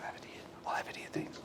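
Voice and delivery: soft whispered thanks, off-mic; whispered aside. Real, not acted. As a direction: whisper a quick thank-you to someone beside you. Aside to someone handing something.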